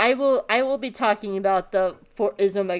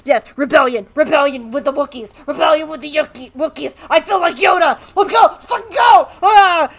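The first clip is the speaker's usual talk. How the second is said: high, loud mock cheering, building to a yell; giddy excitement; 7 semitones higher, 7 dB louder than usual. Echo thrilled about joining the rebellion, giddy and building to shouts. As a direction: whoop it up, louder and higher as it goes.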